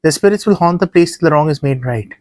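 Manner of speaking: ordinary delivery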